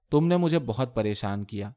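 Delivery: ordinary delivery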